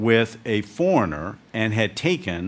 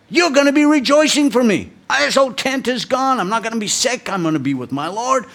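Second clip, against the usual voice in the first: put-on much higher, louder, worked-up old mother's voice; mom scolding; 12 semitones higher, 5 dB louder than usual. Aged mom rebuking her son for planning to mourn, high and loud. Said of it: "She got really mad at me".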